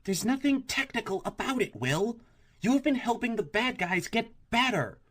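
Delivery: high, upset accusation; indignant. A narrator's reading. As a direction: scold him in disbelief. Kathy indignant at Will.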